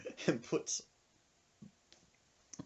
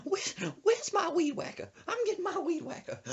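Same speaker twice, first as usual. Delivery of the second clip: high threatening grandma. Retold or imitated. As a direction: announce the weapon as granny. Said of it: "She was like".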